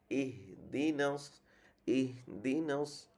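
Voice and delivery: ইহদিনাস drawn out slowly in recitation; slow recited word. Shown as a demonstration. Teacher repeating ইহদিনাস slowly for pupils to copy. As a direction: stretch ইহদিনাস, each sound held long. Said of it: "আমরা সূরা ফাতিহা".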